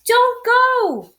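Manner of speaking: high, loud wailing plea. Acted. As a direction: wail to make him stay. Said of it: "wailed Bear".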